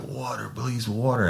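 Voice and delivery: high-pitched imitation of desperate begging; mock pleading. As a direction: plead weakly, as if desperate for water. Imitating sprayed man pleading for water.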